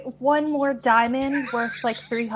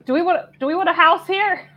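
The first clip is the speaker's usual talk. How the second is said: higher-pitched question called across; yelling over; 7 semitones higher, 5 dB louder, faster than usual. Acted. Player yells over to Asher about a house, calling out. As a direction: project it to someone over there. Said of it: "yell over to".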